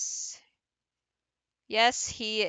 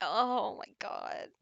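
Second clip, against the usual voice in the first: normal talking voice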